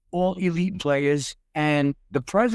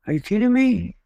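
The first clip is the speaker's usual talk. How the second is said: higher-pitched retold disbelief; incredulous; 6 semitones higher, 4 dB louder than usual. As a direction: replay being taken aback, voice jumping up. Retold reaction to being asked to coach.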